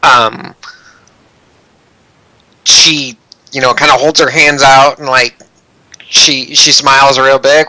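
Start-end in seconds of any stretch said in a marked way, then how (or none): none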